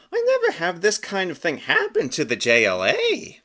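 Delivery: a hint of a puzzled cartoon hero; hero's inner thought. Captain Carrot's thought voiced.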